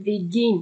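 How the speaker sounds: Begin with weight on "GIN"; begin, light first syllable, stressed second; stress on last syllable. Shown as a demonstration. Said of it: "লাস্টে গিয়ে স্ট্রেসটা বসবে".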